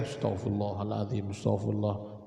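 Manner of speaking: low, even recitation of istighfar; murmured dhikr. Acted. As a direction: repeat it evenly on a low note. Husband recites while wife makes tea.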